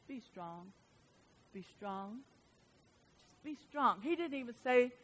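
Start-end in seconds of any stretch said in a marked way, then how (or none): 0.1-2.2 hushed, far softer, almost breathed; voicing God's gentle urging softly; the Lord's soft words. Pastor voicing the Lord's message to church, hushed. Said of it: "I just hear the Lord saying". 3.2-4.0 softly voiced Lord. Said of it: "I just hear the Lord saying".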